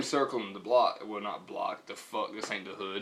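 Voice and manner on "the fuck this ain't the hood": chuckling through the words; laughing. Real, not acted. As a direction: say it while starting to laugh. Joking aside about the parking lot.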